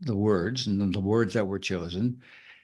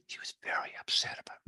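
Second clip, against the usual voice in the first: quick, hushed whisper; secretive whisper; 11 dB quieter, faster than usual. Shown as a demonstration. Teacher showing how to make it secret, whispered. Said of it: "or make it secret".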